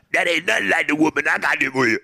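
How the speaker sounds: fast, loud, gruff mock Union Kane; quick, loud parody of a brutish boxer; imitating Union Kane. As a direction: rattle it off like a thug from the movie.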